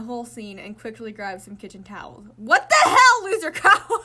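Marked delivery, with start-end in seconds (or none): 2.4-4.0 loud high yell of disbelief; loud exasperated. Acted. Friend sees the mess.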